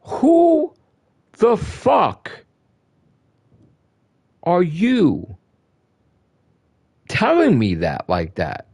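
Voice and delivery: each word pulled apart in disbelief; indignant outburst. Real, not acted. Streamer fires back at a guy ordering him around, indignant and slow.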